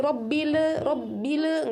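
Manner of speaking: "robbile" with extra e after l; bounced lam. Shown as a demonstration. Teacher warns students, "robbile" with forbidden bounced lam. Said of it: "Hati-hati mantul lagi".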